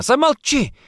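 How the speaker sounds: very high, loud hysterical cry; anguished shriek; screamed. Acted. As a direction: scream to shut him up. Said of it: "закричала она".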